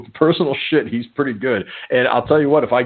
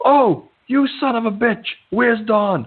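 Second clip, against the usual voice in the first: higher hammed-up insult; mock angry; 10 semitones higher than usual. Tony cursing at the wrong person on the phone, mock rage. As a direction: bark it like an outraged caller, played up. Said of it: "and then he".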